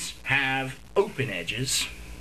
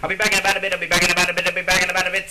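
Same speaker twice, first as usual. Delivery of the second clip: louder, rapid auctioneer patter; 3 semitones higher, 5 dB louder, much faster than usual. Shown as a demonstration. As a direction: rattle it off like an auctioneer. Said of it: "Sound like a fucking auctioneer".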